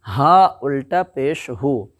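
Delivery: slow, drawn recitation of letters; spelling out syllables. Shown as a demonstration. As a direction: sound out each letter slowly like a Quran teacher. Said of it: "जैसे".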